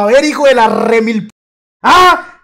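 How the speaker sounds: loud, high mock scolding yell; grandmother's furious interrogation. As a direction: explode into a cursing demand, hammed up. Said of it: "Ella me preguntaba". (abuela furiosa gritando, imitada)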